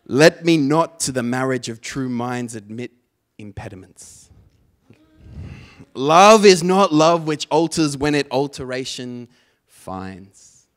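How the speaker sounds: loud, declaimed verse, dropping away then surging; slam poetry recital. Acted. Poet recites a practice excerpt for clicks, slam declamation. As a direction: belt out the sonnet like a slam poet.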